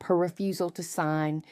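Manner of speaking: ordinary delivery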